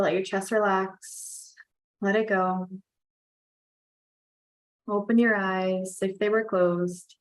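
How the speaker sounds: usual speaking voice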